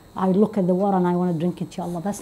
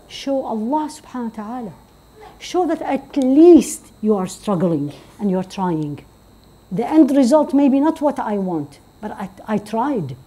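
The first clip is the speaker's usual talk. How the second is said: ordinary delivery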